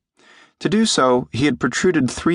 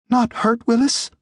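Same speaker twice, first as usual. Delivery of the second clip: put-on anxious little creature in a boy's high voice; high, worried creature question. A narrator's reading. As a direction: ask timidly, very high, pitch held up. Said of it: "he said anxiously in Jim's voice".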